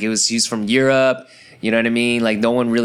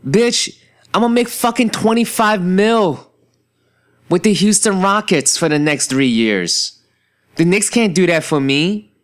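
mock honest player; 7 semitones higher, 3 dB louder than usual.

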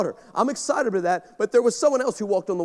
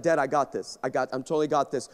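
quiet daughter voice; 7 semitones lower, 5 dB quieter, faster than usual. Retold or imitated. Daughter reassuring dad again.

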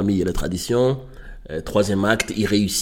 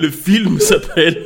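laughing; 8 semitones higher, 5 dB louder than usual. Real, not acted. Laughing as he announces.

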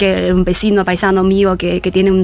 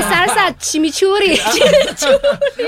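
laughing at the word; 10 semitones higher, slower than usual. Real, not acted.

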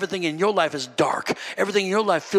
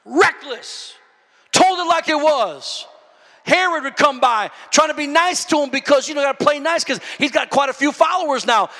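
raised, higher-pitched preacher's delivery; forceful preaching; 8 semitones higher, 5 dB louder than usual. Acted. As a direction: preach it out with punch.

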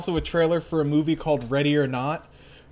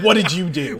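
louder, playfully confrontational question; mock aggressive; 3 semitones higher, 6 dB louder than usual. Replaying own question to cohost, mock aggressive. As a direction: demand it with exaggerated bluntness. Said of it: "didn't mean to sound so aggressive".

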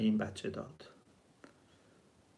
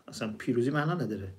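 ordinary talk, nothing marked

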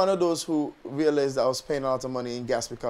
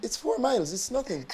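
soft, higher-pitched German client imitation; German accent; 7 semitones higher, 4 dB quieter than usual. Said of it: "That's the best German accent".

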